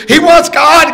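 high, loud urgent relay; mock-urgent flight attendant. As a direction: call it up to the cockpit.

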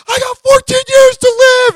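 high, loud whooping cheer; ecstatic shout. Retold or imitated. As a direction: shout it like you won the lottery. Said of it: "I'm like".

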